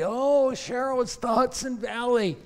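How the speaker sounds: high mocking voice of someone insisting; mock caller. Quoting people who come to her, high and teasing.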